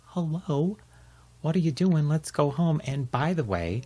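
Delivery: high, bright-voiced dad calling his pup; cheery dad. Dad finding Baxter by the tree, high and cheery. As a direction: call the little dog home, cheerfully high.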